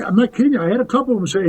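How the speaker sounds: usual speaking voice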